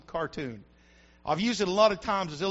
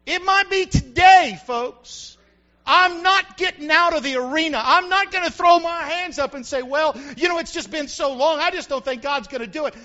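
louder, higher preaching to the church; full-force preaching; 5 semitones higher, 6 dB louder than usual. Acted. Pastor preaching hard to his church, full force. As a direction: preach it out loud to the congregation.

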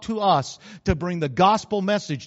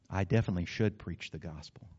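normal talking voice

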